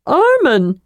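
high, bright-eyed happy call; joyful greeting. A narrator's reading. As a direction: call out his name with delight. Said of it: "greeted him with joy".

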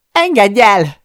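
loud, high-pitched desperate yell. A narrator's reading. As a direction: yell it out, fighting to get free. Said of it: "üvöltöm".

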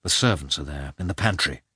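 low, restrained irritation; a hushed, mildly angry warning. A narrator's reading. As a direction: keep the anger down so nobody overhears. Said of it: "he said angrily".

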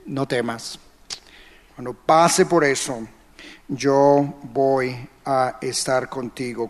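put-on soft and low, like a father holding a scared child; padre tranquilizador. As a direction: hush him gently, slow on estoy. Dios consuela en la tormenta. (tierno y suave, más grave y bajito)

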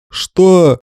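high, loud stunned gasp of a word. A narrator's reading. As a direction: blurt it out in shock. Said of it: "оцепенели прочие рыцари".

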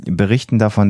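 ordinary delivery

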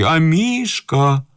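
high, drawn-out call; calling the dog. A narrator's reading. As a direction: coax the dog out with a long call. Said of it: "причмокивая и вызывая Амишку из-под кровати".

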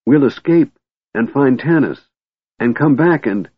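a kender pulling Tika toward fresh air; excited. A narrator's reading. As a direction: gush out the plan eagerly. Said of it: "Tass yelled in excitement".

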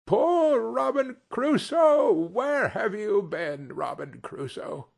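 slow, high parrot-like calling; high wailing cry, easing off at the end. Acted. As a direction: call it out high and drawn out, like a parrot. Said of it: "It was the parrot".

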